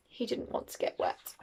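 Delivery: ordinary delivery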